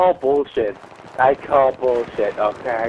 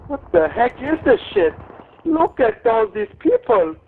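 panicked yelling; 10 semitones higher than usual. Real, not acted. Panicked gaming outburst.

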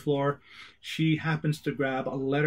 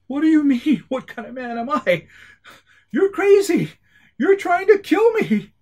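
loud, much higher, incredulous yelling; outraged shout; 13 semitones higher, 9 dB louder than usual. Acted. Agent yells back at the woman who attacked him, loud and high. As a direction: shout it in disbelief, voice cracking high.

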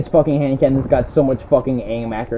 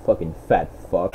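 muttered insult; 11 semitones lower, 9 dB quieter than usual. Real, not acted. Insulting fat player.

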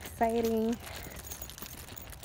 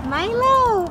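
much higher, loud cry of the name; high, loud squeal of joy; excited cry; 13 semitones higher, 12 dB louder than usual. Real, not acted. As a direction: shout the dog's name in delight. First sight of the groomed dog.

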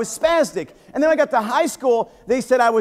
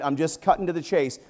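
ordinary talk, nothing marked

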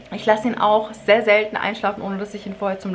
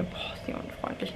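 hushed, breathy whisper to his wife; whispered aside; 15 dB quieter than usual. Retold or imitated. Husband whispering to wife about rude clerk, hushed whisper. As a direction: whisper it so the clerk can't hear.

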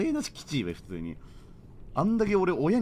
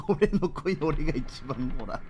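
ordinary delivery

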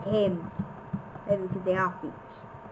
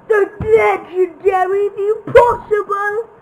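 higher, louder grand build-up; mock epic reveal; 8 semitones higher, 7 dB louder than usual. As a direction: announce it like a legendary treasure. Grand reveal of crafting table.

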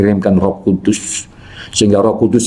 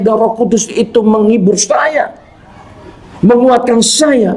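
high, fervent preacher's voice; impassioned preaching; 13 semitones higher than usual. Real, not acted. Preacher testifying how the Spirit comforted him, impassioned preaching. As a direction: testify at a high pitch, stretching the climactic phrases.

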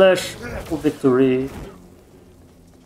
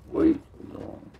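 high whisper; 7 dB quieter than usual. Pretend. Repeats phrase high and unvoiced.

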